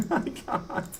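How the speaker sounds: breathless laugh through the words. Real, not acted. Streamer laughing at his broken audio. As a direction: laugh it out, barely voiced.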